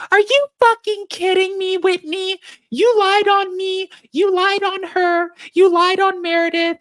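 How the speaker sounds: high-pitched, loud mock screaming; imitating a scream. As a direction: shriek it at her like you've snapped. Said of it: "All three of them are screaming at Whitney".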